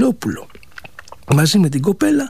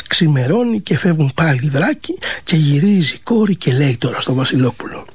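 ordinary delivery

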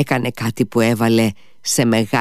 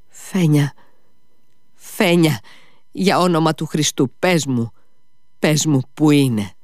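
higher-pitched, urgent begging; pleading; 5 semitones higher than usual. A narrator's reading. Mitya on his knees begs Fenya, pleading. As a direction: implore her, phrase by phrase.

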